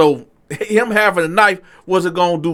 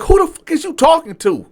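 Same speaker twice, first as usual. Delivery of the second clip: acted challenge, pitched way up; angry comeback; 13 semitones higher, 4 dB louder than usual. Customer squaring up to the waiter, angry comeback. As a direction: throw it back, high and indignant.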